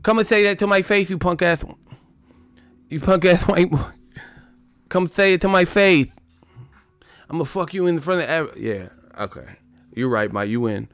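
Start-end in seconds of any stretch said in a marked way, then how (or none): 0.0-8.6 higher, heated threat voice; Tyson threat. Retold or imitated. Comic voices Tyson threatening a reporter, high and heated. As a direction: taunt a reporter like a young Tyson.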